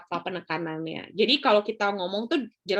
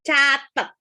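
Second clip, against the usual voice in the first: "Catat" punched louder; stressed word; 3 semitones higher, 6 dB louder than usual. Shown as a demonstration. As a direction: hit the word "Catat" hard. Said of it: "itu adalah penekanan". (tekanan keras pada "Catat")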